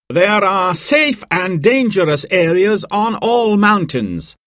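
high, slow briefing voice; mountain guide explaining. A narrator's reading. Guide Dan briefs the guests.

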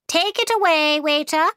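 high, commanding queen's order; imperious order. A narrator's reading.

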